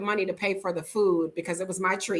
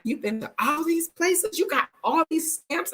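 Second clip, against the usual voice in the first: retold amazement; 9 semitones higher than usual. Man amazed at passport stamps.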